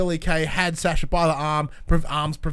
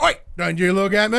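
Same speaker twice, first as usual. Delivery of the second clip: loud, higher-pitched broad Aussie heckle; mock Aussie yell; 5 semitones higher, 7 dB louder than usual. Imitating Billie yelling at Sasha, hammed-up Aussie outrage. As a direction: play up the Aussie indignation. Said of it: "They're playing the Aussie stick so well".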